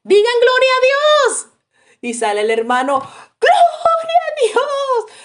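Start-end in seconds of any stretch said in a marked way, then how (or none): 0.0-1.5 much higher, loud call to praise; rally the whole church; rousing call. Retold or imitated. The preacher rallying his congregation, high and loud. 3.0-5.2 high, slow, drawn-out shout; shouted through pain. Retold or imitated. The hurting man answering the preacher with a long high shout. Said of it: "lo gritó hasta con dolor".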